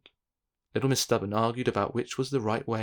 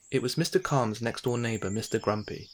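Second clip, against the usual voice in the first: plain reading voice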